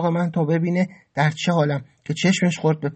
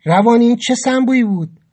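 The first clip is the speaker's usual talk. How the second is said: raised, irritated challenge; angry; 6 semitones higher, 5 dB louder than usual. A narrator's reading. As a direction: snap the question in anger. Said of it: "با عصبانیت گفتم". (عصبانی و پرخاشگر)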